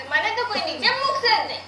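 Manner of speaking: normal talking voice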